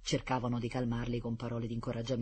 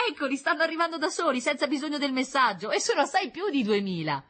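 put-on excited boy; 13 semitones higher, 6 dB louder than usual.

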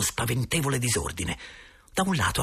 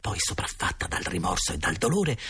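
plain reading voice

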